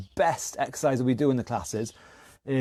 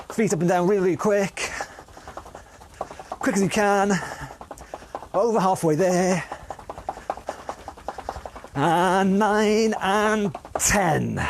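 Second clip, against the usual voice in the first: energetic coaching; 5 semitones higher than usual. Acted. Urging class through fast feet.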